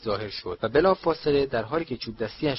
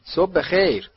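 higher, louder friendly hello; pitched-up, cheery good morning; cheerful greeting; 6 semitones higher, 7 dB louder, slower than usual. A narrator's reading. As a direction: greet a stranger brightly and heartily. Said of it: "آقای ویزلی با خوشرویی گفت".

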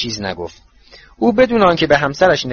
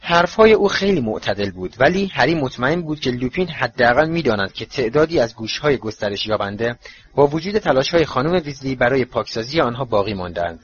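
ordinary delivery, nothing marked

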